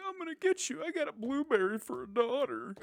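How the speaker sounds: high put-on character voice; mock outraged father. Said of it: "he's like".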